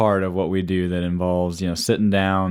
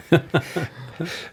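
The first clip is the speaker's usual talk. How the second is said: light laugh; 9 semitones higher than usual. Real, not acted. Amused at the snacks joke.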